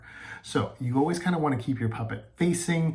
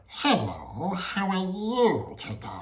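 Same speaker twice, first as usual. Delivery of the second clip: high, slow, friendly puppet greeting; higher sing-song hello from a friendly puppet; sweet puppet voice; 8 semitones higher, much slower than usual. Shown as a demonstration. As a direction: make the puppet sound sweet and polite. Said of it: "a voice that probably sounds like".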